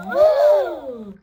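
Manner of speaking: loud, high whoa; amazed gasp. Acted. Peppa amazed by Grandpa's decorations, loud whoa. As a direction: gasp in wonder at the box. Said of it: "gasped Peppa".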